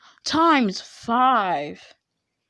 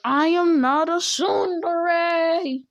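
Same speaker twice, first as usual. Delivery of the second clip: high, stretched-out mock outrage; whiny indignant protest; 6 semitones higher, much slower than usual. Teased girl denying the tsundere act, whiny and indignant. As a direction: drag out a high, whiny denial.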